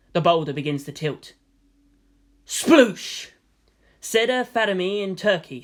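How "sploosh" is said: wet splat sound. A narrator's reading. People exploding into goop.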